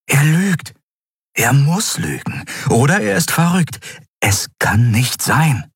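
leicht aufgewühlt, erhöhte Stimme; ungläubige Gedanken. A narrator's reading. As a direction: wehr dich innerlich gegen die Wahrheit. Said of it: "Endriels Gedanken rasten". (fassungslos, zunehmend aufgewühlt)

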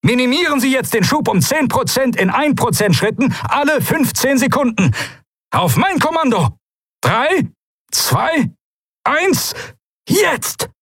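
high-pitched, urgent orders and countdown; called-out command. A narrator's reading. Commander counting the pilots down to reduce thrust, calling out. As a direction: call orders to the whole fleet. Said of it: "rief Quailor".

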